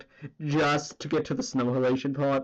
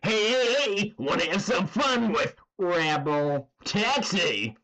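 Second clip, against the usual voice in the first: higher, slow hammed-up hype call; Rebel Taxi quote; 5 semitones higher than usual. Streamer redoing the Rebel Taxi line, higher and hammed up. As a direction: sell it like a goofy ad host. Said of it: "repeats the Rebel Taxi quote".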